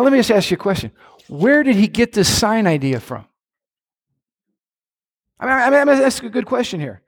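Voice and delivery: normal talking voice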